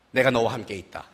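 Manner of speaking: deep, soft voice of God; God's low reply. Retold or imitated. God quietly answering a frantic believer. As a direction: calmly cut the panic short. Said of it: "하나님, 저는 어떻게 살아요?".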